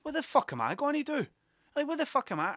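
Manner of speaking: high, panicked mock despair. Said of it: "And I remember going".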